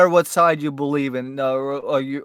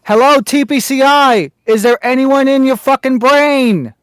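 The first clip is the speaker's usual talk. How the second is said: loud, high exasperated rant; 7 semitones higher, 8 dB louder than usual. Real, not acted. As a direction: yell at them in disbelief.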